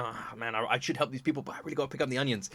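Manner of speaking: soft, grumbling excuses, rushed at the end; hushed mock reluctance, speeding up; reluctant mumble. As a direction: mutter like a hero who'd rather do errands. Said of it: "He's got a shopping list".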